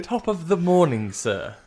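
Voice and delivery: mock posh accent. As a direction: hail a gentleman in posh English. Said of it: "yeah, very posh".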